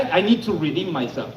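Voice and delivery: ordinary talk, nothing marked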